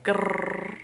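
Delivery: frustrated growl. Retold or imitated. Frustrated at the secret.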